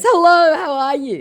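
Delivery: squeaky overfriendly con man; hammed-up scammer voice. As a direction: play the oily scammer saying hello. Scammer's chat message voiced.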